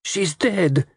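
high-pitched shocked cry. A narrator's reading. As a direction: cry it out in alarm. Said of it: "Lola cried".

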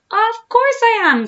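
high, loud, drawn-out declaration; gleeful boast. A narrator's reading. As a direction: crow it slowly at the top of the voice. Crocodile gleefully admits his plan.

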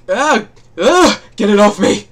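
panicked loud yelp, building; shuddering revulsion. Real, not acted. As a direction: squeal to get it off you. Recoils from creature on screen.

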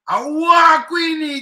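slow, loud, high shout. Real, not acted. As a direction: bellow the name out slowly. Worked-up shout.

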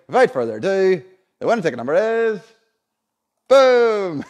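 building up to a big loud boom; excited reveal. Real, not acted. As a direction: announce the winner with a rising burst. Building to the winning number reveal.

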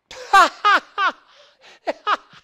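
loud high cackling laugh at him; mocking laughter. Friends laughing at Tumba.

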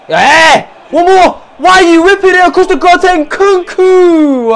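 high, loud, stretched protest; frustrated shouting. Real, not acted. Fan berating players over a cross, frustrated shouting. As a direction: yell it at the players, high and drawn out.

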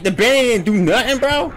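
incredulous high squeak; shocked disbelief. Real, not acted. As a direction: pitch shoots up in disbelief. Outraged the item failed.

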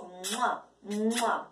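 dos besitos suaves y tronados; besos sonoros. Acted. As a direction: lanza besos a la gallina. Besos imitados. (besos tronados)

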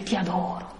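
a soft, breathy whisper of devotion; hushed adoring whisper. Pretend. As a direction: breathe it like a small voice of adoration. Imagined sun speaking to Jesus.

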